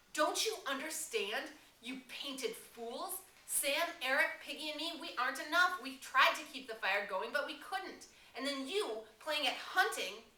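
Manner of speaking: high, loud, cracking furious yell; voice cracking with rage. Acted. Ralph raging at the painted hunters, voice cracking. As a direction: scold them with broken fury. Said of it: "A gust of rage shook Ralph. His voice cracked".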